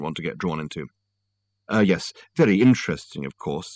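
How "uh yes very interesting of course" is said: ordinary delivery, nothing marked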